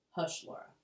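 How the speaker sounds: a hushed, stern whisper; quiet her firmly under your breath. Acted. Pa sternly hushes little Laura, whispered. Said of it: "Pa told her sternly".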